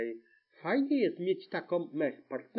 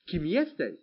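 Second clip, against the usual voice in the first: plain reading voice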